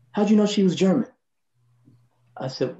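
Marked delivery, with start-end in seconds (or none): 0.1-1.1 higher raspy Miles imitation, sly question; [name] voice. Miles needling the bassist about the German lady, raspy. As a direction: tease it like Miles fishing for gossip.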